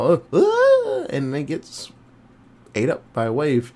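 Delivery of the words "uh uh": a very high, strained squeal of fake terror; mock panic scream. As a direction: squeak out the panic of beachgoers about to be swallowed. People panicking before the wave.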